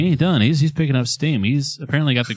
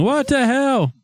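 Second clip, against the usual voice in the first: high-pitched, incredulous exclamation; startled outcry; 11 semitones higher, slower than usual. Real, not acted. Reacting to the thing moving by itself, startled outcry. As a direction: cry out in disbelief.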